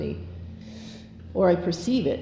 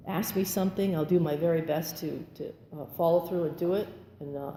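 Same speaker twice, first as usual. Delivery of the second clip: ordinary delivery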